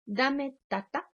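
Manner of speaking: lento, agudo, vocecita de niño; voz de niño. Retold or imitated. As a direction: imita al niño pidiendo tata. Niño pide galleta. (niño pequeño pidiendo tata, lento)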